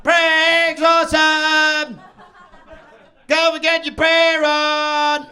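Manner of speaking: hammed-up muezzin. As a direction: lazily sing out the prayers like a bored caller. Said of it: "he's giving the whole".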